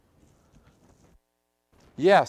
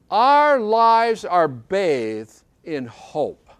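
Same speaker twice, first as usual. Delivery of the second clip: very slow and louder, every word stretched; slow emphatic declaration; 5 dB louder, much slower than usual. Acted. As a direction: let each word land on the congregation. Preacher drives home his point.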